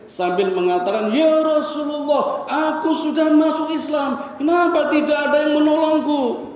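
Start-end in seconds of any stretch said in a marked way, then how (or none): 1.1-6.5 retold crying plea. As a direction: beg for help as the chained son, rising to a cry. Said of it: "nangis, jerit-jerit".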